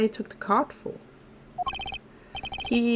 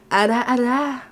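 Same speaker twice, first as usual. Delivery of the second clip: teasing ara ara; 3 semitones higher, 4 dB louder than usual. Shown as a demonstration. Streamer practising an anime tease for chat, coy and playful. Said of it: "I'm not sure if I can good ara aras".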